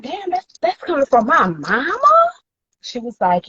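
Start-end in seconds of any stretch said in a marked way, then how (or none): 0.0-2.3 high, hushed, then rising in disbelief; stunned disbelief. Retold or imitated. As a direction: say it like you can't believe your own mother did it. Hurt disbelief at mother.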